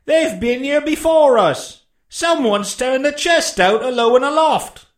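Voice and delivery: high, loud, slow call from above; cried report. A narrator's reading. Man at the window to blind beggar, high calling voice. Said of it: "he cried".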